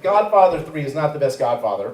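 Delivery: usual speaking voice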